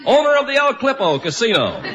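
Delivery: usual speaking voice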